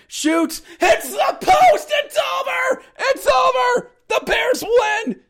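very high shouting, easing at the end; jubilant victory call. Retold or imitated. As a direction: yell that the game is won. Calls the winning moment.

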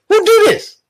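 high, incredulous question; baffled disbelief. Real, not acted. Host asks who follows such a preacher, incredulous. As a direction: throw it out high, utterly baffled.

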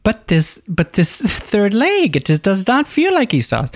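high put-on voice of doubt; mock puzzled father. Riffs as Isaac feeling Jacob.

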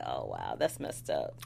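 low, soft aside; hushed mock dismay. Viewer reacts to Valentine's gloating, hushed dismay. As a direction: drop way down, as if taken aback.

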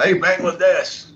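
high, drawn-out imitation of a friend calling; stretched, high-pitched mock greeting; teasing nickname call. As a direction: imitate him hailing you by nickname, slowly. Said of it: "so he called me Bangladesh from".

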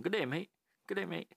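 attempted Australian accent. Tourist greeting drop bears in the forest, poor Australian accent. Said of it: "It's not an accent".

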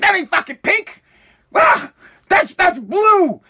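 high-pitched mock protest; mock outraged objection. Imitating Democrats contradicting the president, mock outrage. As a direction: object in a high, exaggerated whine of disbelief. Acting Democrats disputing the color.

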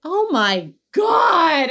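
loud, high, drawn-out shock; appalled disbelief. Real, not acted. Co-host reminded of an outrageous industry report, appalled.

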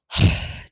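loud, forceful sigh; deep cleansing breath. Real, not acted. Nurse resetting after a day of contempt, deep cleansing sigh. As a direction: let out a big cleansing exhale. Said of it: "Deep cleansing breath".

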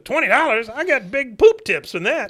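high, loud, indignant mock scoff; scornful retold outburst. Father scoffs at son's twenty dollars, loud indignation. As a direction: imitate a father outraged at the offer.

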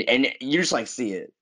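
ordinary talk

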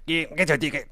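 lightly voiced crab hawker; a touch of a ticket-selling crab. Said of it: "what was my crab voice".

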